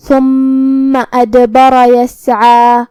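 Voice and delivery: very slow chant of ثم ادبر یسعا with long held final a; lengthened madd. Shown as a demonstration. Teacher models the rules, ثم ادبر یسعا chanted with long ending. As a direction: hum the double m, bounce the d, stretch the end of ثم ادبر یسعا. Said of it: "دو حرف کے برابر اپنی آواز لمبی کریں گے".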